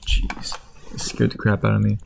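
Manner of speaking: ordinary talk, nothing marked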